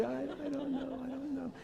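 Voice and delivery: mock helpless mother; defeated mother. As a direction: sigh it out like a worn mother. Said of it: "they're shaking their head".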